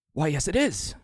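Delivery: put-on a kindly, confused old passenger; old man Michael.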